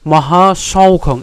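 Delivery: slow, drawn-out "মহাসৌখং"; slow word demo. Shown as a demonstration. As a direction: stretch "মহাসৌখং" for students. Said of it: "teaching pronunciation".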